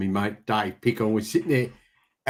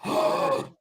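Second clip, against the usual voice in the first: high, drawn-in gasp; mock gasp. Reacting to Starlink talk, high amazed gasp.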